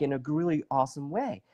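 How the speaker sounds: ordinary delivery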